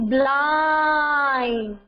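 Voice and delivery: "blind" with a very long vowel; teacher's slow, elongated "blind"; drawn-out sounding out. Shown as a demonstration. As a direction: stretch "blind" slowly for dictation. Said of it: "Two syllable word".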